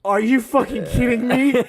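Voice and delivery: high, slow, strained outrage; hammed-up incredulous whine; exasperated disbelief. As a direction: drag it out in pained disbelief. Acts out his own disbelief.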